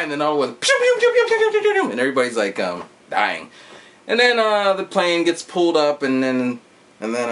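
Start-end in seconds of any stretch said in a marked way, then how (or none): 0.6-1.9 high, rapid-fire pew noises; squealed shooting sound effect; imitated gunfire. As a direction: spit out cartoon laser shots.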